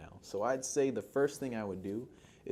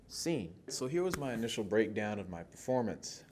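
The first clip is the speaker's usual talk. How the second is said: usual speaking voice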